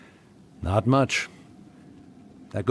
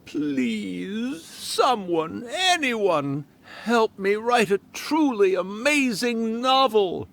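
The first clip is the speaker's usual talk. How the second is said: higher, pleading human voice; mock plea for help; 8 semitones higher, much slower than usual. Kitsune retelling a human's cry for help, mock pleading. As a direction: beg for help in a borrowed voice. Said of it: "I suddenly heard someone yelling".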